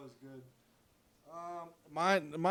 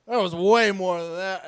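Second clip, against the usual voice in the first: indignant golfer; 5 semitones higher, 16 dB louder than usual. Retold or imitated. Golfer argues about the break.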